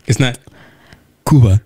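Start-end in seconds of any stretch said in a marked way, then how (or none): 1.3-1.6 Cuba with a firm "KOO" opening, louder; corrected pronunciation. Shown as a demonstration. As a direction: demonstrate Cuba with a pure oo. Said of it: "get it right".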